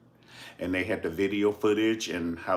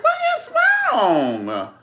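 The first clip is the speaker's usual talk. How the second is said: high, loud puzzled squeak; bewildered little girl; squeaky, bewildered little girl; confused child; 9 dB louder, slower than usual. Retold or imitated. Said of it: "And she was like".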